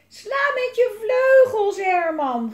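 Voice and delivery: high, stretched scream falling at the end. A narrator's reading. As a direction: yell urgently to someone far away. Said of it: "gilde ze".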